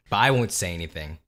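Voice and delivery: ordinary talk, nothing marked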